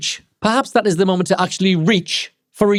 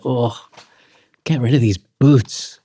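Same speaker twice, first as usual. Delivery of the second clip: slow, weary mock exasperation; fed-up sigh; 5 semitones lower, much slower than usual. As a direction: drag it out like you're sick of them. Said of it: "you're just like".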